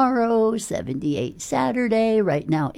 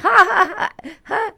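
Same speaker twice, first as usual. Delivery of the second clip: Pee Wee Herman laugh; 12 semitones higher, 5 dB louder than usual. Retold or imitated. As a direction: belt out a squeaky goofy man-child cackle to prove women can do impressions. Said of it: "make your request like Pee Wee Herman".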